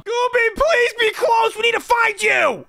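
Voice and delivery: high pleading call across the building; desperate calling. Acted. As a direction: call out for him, begging. Desperate search for Gooby.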